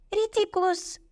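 high cried-out spell. A narrator's reading. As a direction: cry the incantation on a very high pitch. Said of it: "крикнула Парватці".

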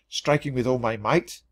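ordinary delivery, nothing marked

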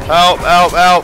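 loud urgent call for help. Real, not acted. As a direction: yell for help. Calling for help in game.

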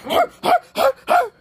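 high yelled chant; cheering shout. Real, not acted. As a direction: urge him on, yelling. Cheering friend to pull.

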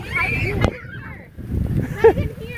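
loud call across the playground. Real, not acted. Mother calling to child in the rain.

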